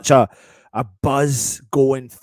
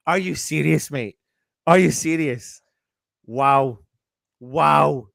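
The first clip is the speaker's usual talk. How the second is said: delighted disbelief; 4 dB louder, much slower than usual. Real, not acted. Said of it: "disbelief at result".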